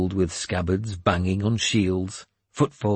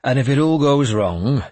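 louder, higher, pressing instruction; commanding warlord; 5 semitones higher, 6 dB louder than usual. A narrator's reading. Warlord adding an order for the boy, commanding. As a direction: press the order home with a raised voice. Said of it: "I added".

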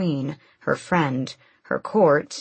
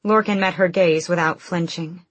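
ordinary delivery

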